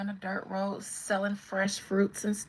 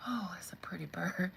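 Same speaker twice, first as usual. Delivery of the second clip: hushed mock wonder; soft, whispery awe, hammed-up; 2 semitones lower, 7 dB quieter than usual. Star dodging fans' album question, hushed pretend awe. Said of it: "she be like".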